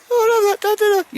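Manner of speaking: very high, loud squeaky pup voice; dog's voice. Pretend. Owner voicing his dog Baldrick to Al, high squeaky dog voice. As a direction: speak for the dog in a squeaky falsetto.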